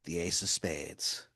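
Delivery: inner thought. Acted. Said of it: "in your head, you go".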